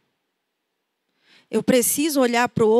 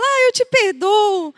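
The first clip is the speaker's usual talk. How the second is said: higher, slower sweet forgiving; tearful forgiveness; 7 semitones higher, 5 dB louder, slower than usual. Retold or imitated. The sanguine person forgives after crying, sweetly. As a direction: forgive with a melting heart. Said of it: "ele enxuga as lágrimas e fala".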